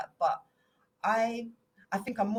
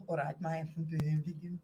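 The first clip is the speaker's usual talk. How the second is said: broad Brummie vowels; Birmingham accent. Shown as a demonstration. Showing friends his Birmingham accent, broad Brummie. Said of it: "And Birmingham".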